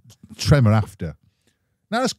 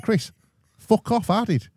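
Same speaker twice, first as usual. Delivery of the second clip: higher, mock-outraged comeback; indignant retort; 10 semitones higher than usual. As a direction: throw it back at him, offended. Retelling argument with brother.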